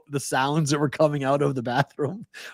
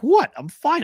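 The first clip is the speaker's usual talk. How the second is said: incredulous; 5 dB louder, slower than usual. Retold or imitated. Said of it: "I'm like".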